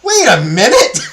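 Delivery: high, loud exclamation of realization; loud disbelief. Real, not acted. Speaker stunned by the computer being non-binary, loud disbelief. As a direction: burst out as the absurdity lands.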